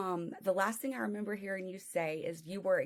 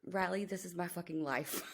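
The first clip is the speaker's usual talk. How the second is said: ordinary delivery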